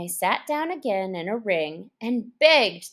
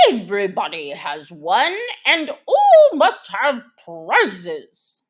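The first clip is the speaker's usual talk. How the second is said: dodo's pronouncement; 8 dB louder than usual. A narrator's reading. Dodo announces.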